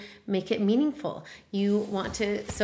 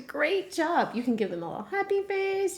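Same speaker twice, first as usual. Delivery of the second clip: cheerful praise; 8 semitones higher than usual. Pretend. Praising child in lesson.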